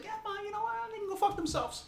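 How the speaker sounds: high mock mother telling critics off; mocking his mother. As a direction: toss it off like a sassy mother. Voicing his mother's defiant retort.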